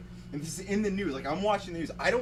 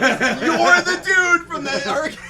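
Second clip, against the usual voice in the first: high, loud, laughing shock at spotting the hurricane guy; excited recognition; 10 semitones higher, 12 dB louder than usual. Retold or imitated. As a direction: shout it in laughing disbelief. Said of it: "and I was like".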